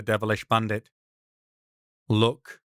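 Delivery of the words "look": one word, Look, stressed; emphasis on look. Acted. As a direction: Look with strange weight. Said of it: "that same strange pronunciation, the emphasis".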